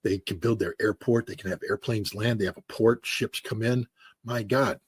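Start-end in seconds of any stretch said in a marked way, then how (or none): none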